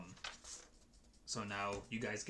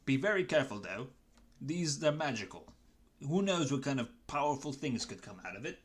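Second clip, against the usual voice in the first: higher, louder Cockney goblin like Michael Caine; grateful Cockney goblin, pitched up and emphatic; Marjode; 4 semitones higher, 6 dB louder than usual. Acted. Goblin handing over magical beans as reward, Cockney character voice. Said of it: "I'm gonna do a quick character voice".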